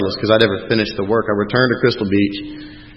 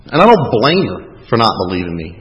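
usual speaking voice

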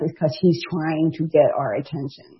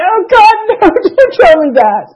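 higher, louder anguished wail; horrified cry; 9 dB louder than usual. Retold or imitated. To coworkers about the salad dressing, a horrified wail. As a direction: cry out in horror at the news.

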